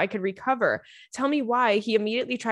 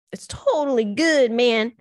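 louder, higher surfer-dude drawl; loud hammed-up surfer dude; bro voice; 3 semitones higher, 4 dB louder, slower than usual. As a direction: play a chill bro. Dude voice on sponsor drink.

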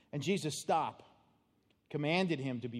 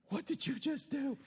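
high-pitched dismayed question, imitated; college student nursery worker; 11 semitones higher than usual. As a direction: lightly mimic an appalled nursery worker. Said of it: "this college student is like".

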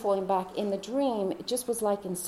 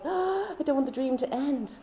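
put-on childlike longing; 7 semitones higher than usual. Waking from the lovely dream.

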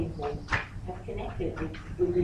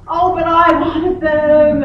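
loud, high, drawn-out whine; hammed-up sulky lament, slow and loud; mock whining protest; 6 semitones higher, 12 dB louder, slower than usual. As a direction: wail it like a disappointed daughter. Daughter protests losing the jeans.

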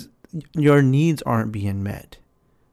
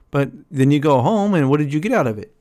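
usual speaking voice

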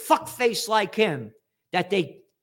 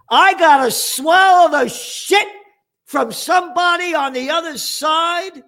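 hammed-up whiny dupe; mock gullible reader; 11 semitones higher, 9 dB louder than usual. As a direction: parody a sheep swallowing propaganda. Mocking a gullible news reader.

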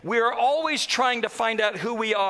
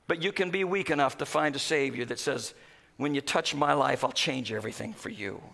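low, soft preaching; quiet pulpit intimacy; hushed sermon; 9 semitones lower, 6 dB quieter than usual. Acted. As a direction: drop to a hush for the promise. Sermon drops to hush.

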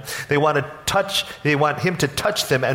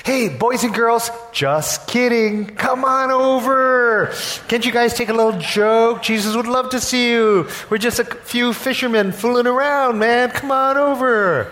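sugary backpedaling disciples; 8 semitones higher than usual. Pretend. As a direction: coax the kids over, faking friendliness. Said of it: "the disciples then say to the children".

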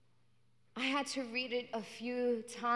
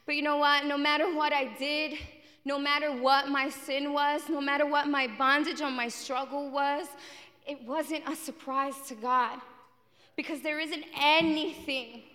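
louder, higher, preaching at full force; rising proclamation; 3 semitones higher, 5 dB louder than usual. Real, not acted. Preacher declaring God's love to the congregation, loud. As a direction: proclaim it to the whole room.

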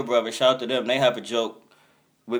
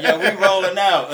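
high, louder laughing mock quote; 7 semitones higher, 8 dB louder than usual. Friends heading out of the club, loud laughing quote. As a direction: laugh it out while quoting.

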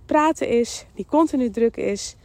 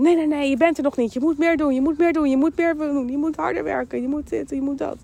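higher, hurried imitation of a stressed inner voice; busy nagging voice; 4 semitones higher than usual. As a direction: run the demands together without a breath, like a pestering head voice. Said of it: "van die drukke stemmen in je hoofd, die continu zeggen".

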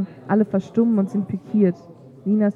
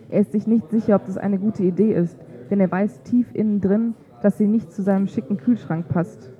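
plain reading voice

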